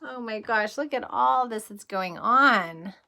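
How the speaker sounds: plain reading voice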